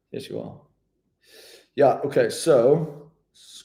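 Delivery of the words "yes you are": much lower, much softer teasing reply; playful deep self-rebuttal; mock inner voice. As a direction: answer yourself in a put-on deep voice. Arguing with himself, playful.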